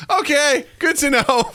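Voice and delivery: high-pitched, put-on "okay"; retold mock acceptance. Replying to Jessica's advice after the show, mock acceptance. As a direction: take the late tip in a high mock-agreeable voice.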